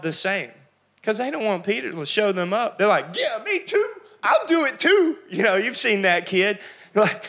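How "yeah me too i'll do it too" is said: eager disciples. Acted. Disciples eagerly echo Peter.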